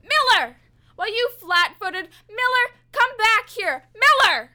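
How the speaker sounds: loud, high desperate calls; frantic yelling. Acted. Prisoner yells after the cop who left him in the dark. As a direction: scream for him to come back.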